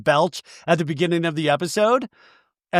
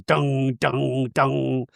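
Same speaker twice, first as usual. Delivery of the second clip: low, even "dung" three times; dramatic sting imitation; 3 semitones lower, 3 dB quieter, much slower than usual. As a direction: voice an ominous editing sound effect.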